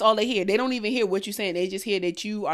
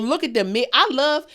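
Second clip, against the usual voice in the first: usual speaking voice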